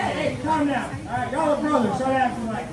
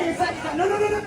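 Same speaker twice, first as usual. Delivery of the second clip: ordinary talk, nothing marked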